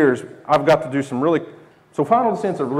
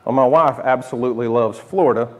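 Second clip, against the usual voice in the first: ordinary delivery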